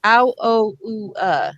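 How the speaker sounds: "Ow, o, oo, uh" as four separate pure vowels; isolated vowel sounds. Shown as a demonstration. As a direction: recite the OU sounds "Ow, o, oo, uh" evenly. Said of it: "there are four sounds of the O U".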